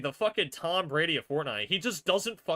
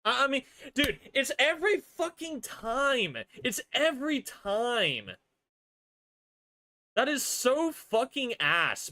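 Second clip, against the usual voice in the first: high-pitched, aggrieved complaint; exasperated rant; 9 semitones higher than usual. Real, not acted. Fan fed up with losing every time, high exasperated rant. Ranting that the rival always wins.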